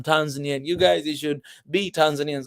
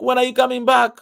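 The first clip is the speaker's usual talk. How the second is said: higher, louder pleading question; imitated eager kids; 6 semitones higher, 6 dB louder than usual. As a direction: imitate kids eagerly asking for your return. Acting out kids begging him back.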